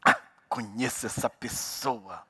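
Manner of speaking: low, slow, hushed judgement; mock sage insight, drawn out; knowing suspicion. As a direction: murmur it as if you have them figured out. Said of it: "a pessoa faz um ato simples assim".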